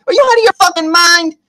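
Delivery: acted indignant question, pitched high; indignant outburst. Man punches her right back, indignant outburst. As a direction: demand it in mock outrage.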